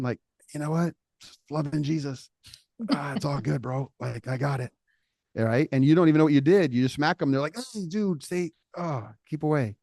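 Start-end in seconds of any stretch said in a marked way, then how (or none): 0.5-4.7 forced cheerful brush-off, higher and softer; grinning and bearing it. Acted. Hurting kid insists to friend he's fine, forced cheer. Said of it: "just grinning and bearing it". 7.6-9.8 much higher, jumpy and softer, backing off; startled flinch. Acted. Smacked kid backs away from his friend, startled and wary.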